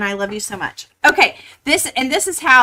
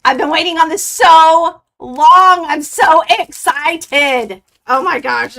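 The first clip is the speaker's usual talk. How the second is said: loud excitement; 6 semitones higher, 10 dB louder than usual. Real, not acted. Finally opening the box.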